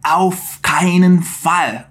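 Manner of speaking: very slow firm "Auf keinen Fall"; slow, firm German no; flat refusal. Retold or imitated. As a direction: drag out the refusal. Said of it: "Ele diz".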